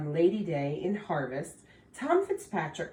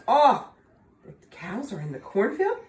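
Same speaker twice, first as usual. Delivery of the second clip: surprised Tom; 5 semitones higher, 7 dB louder than usual. Acted. Tom alarmed about the cows.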